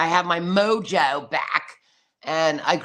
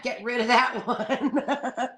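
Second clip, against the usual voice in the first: drawn-out words breaking into laughter; slow, higher, laughing dismissal; 4 semitones higher, slower than usual. Real, not acted. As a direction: let a real laugh take over the line. Laughs while mocking the voice option.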